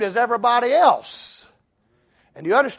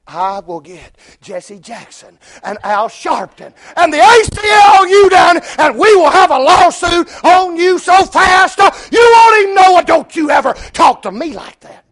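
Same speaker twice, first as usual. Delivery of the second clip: indignant threat building to a high shout; mad, bristling; 10 semitones higher, 13 dB louder than usual. Acted. Insulted woman threatening a lawsuit, shouted indignation. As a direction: blow up loud and high in mock outrage. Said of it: "bristle up and get mad and say".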